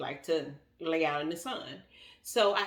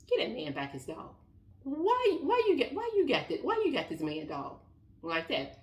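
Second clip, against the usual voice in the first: scolding officer; 9 semitones higher than usual. Retold or imitated. Police scolds the woman.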